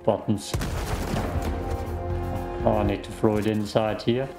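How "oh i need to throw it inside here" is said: ordinary delivery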